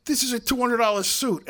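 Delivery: indignant outburst. Retold or imitated. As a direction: play the offended guy snapping back. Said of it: "And the guy jumps up ... and says".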